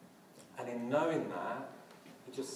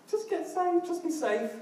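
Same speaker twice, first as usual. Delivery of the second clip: much higher, louder voiced-up wife; mock worried wife; 9 dB louder than usual. Wife on the phone urging him to stay safe, mock-anxious. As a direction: squeak it out like an anxious spouse.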